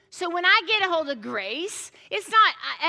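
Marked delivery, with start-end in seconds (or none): none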